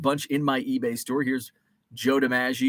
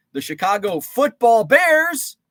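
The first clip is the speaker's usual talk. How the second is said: high, loud, slow hype announcer; 8 semitones higher, 8 dB louder, slower than usual. Pretend. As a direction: boom the team name like a stadium announcer. Emphatic team name, higher louder.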